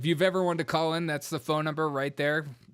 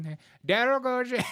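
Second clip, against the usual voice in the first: mock Indian accent; 9 semitones higher than usual. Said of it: "You're like".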